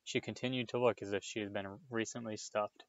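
ordinary delivery, nothing marked

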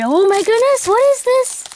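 high-pitched thrilled disbelief; squeaky burst of amazement; excited. Real, not acted. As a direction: voice shooting up with excitement. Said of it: "I'm excited".

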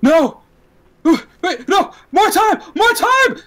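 high, loud, frantic shouting; panicked shout. Acted. Man in purple shouting as the gunman approaches, panicked. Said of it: "you hear him shout".

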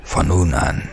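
low, unvoiced, otherworldly whisper; eerie bone-master voice. Bone master anoints his new acolyte in a ghostly whisper. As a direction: hiss the new name like an ancient rite. Devon names Timo Devox.

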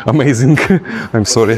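ordinary talk, nothing marked

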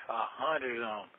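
ordinary talk

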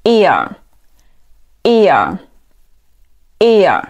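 "ear" slowly, each one drawn out; model pronunciation. Shown as a demonstration. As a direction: repeat "ear" slowly with long pauses. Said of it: "读作". (慢速领读ear，每遍停顿)

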